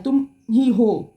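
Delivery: slow, tender confession; a partner's inner voice, slowly and tenderly; tender heart voice. Pretend. Partner's heart speaks to viewer.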